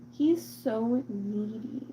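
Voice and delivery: ordinary delivery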